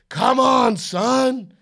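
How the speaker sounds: loud, high mock yell at his son; mock angry dad yell. Dad mocking a yelling father at practice, mock shout. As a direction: bark it like a frustrated little-league dad. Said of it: "I do not hit him with the".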